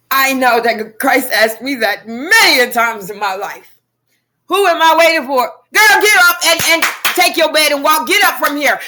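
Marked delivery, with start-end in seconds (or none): 4.5-8.8 loud, high, urgent self-rebuke; rousing command. Acted. Speaker telling herself to get up and walk, rousing command. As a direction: order her up on her feet, loud and high.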